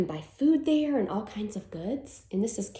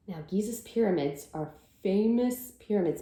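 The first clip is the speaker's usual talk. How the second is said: ordinary delivery, nothing marked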